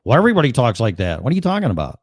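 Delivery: indignant Chicago-Jersey rant, pitch climbing high; Chicago-Jersey accent. Acted. Local insisting he has no accent, indignant Chicago-Jersey. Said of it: "there's the Chicago slash Jersey accent".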